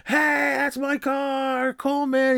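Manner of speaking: high, slow, drawn-out mock yell. Winthorpe yelling at the chauffeur who drove off, high and drawn-out. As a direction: stretch it out like a posh man outraged. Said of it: "He starts yelling at him".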